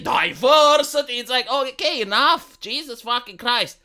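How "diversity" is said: slow, louder mock announcement; sarcastic slogan, drawn out. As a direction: boom it out like a stadium slogan. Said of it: "You're watching politics".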